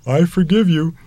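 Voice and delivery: imitating one's own child, high-pitched; child's voice. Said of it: "they'll come out and they'll say".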